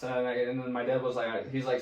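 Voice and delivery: ordinary talk, nothing marked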